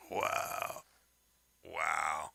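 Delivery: quietly floored, half-whispered; stunned whisper. Retold or imitated. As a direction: let the wows fall out in disbelief. Speaker's stunned reaction.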